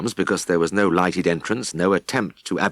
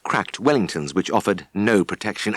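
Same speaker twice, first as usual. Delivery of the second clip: usual speaking voice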